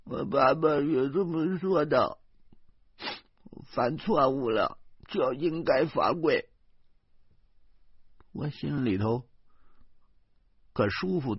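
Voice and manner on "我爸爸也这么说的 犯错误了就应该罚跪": high, whimpering little voice; crying, slowed-down child's speech; tearful child. Acted. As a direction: recite it through tears like a small child. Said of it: "豆豆却哭着说".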